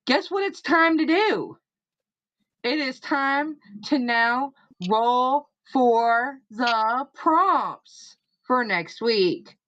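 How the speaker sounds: announcer build-up. Acted. Host hyping viewers for the prompt roll, slow announcer voice. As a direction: stretch it like a game-show host.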